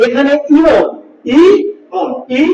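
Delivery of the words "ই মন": ই set apart from মন with a clear break; split into syllables. Shown as a demonstration. Teacher showing students ইমন splits into ই and মন, separated. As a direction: say ই, pause, then মন. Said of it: "আলাদা বসে একটা দল তৈরি করলো".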